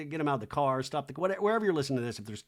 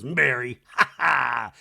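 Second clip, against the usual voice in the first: imitated laughing; 3 semitones lower, 6 dB louder, slower than usual. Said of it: "Angie's laughing like".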